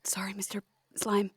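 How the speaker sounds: whispered apology to the slime. Acted. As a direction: breathe the apology without voice.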